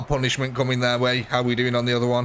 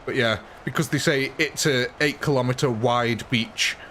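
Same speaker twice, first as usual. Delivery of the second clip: normal talking voice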